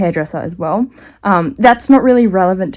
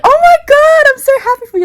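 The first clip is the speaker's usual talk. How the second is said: much higher, shrieking mock joy; gushing delight; 4 dB louder, faster than usual. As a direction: squeal it like a thrilled hairdresser. Hairdresser gushing over fake pregnancy.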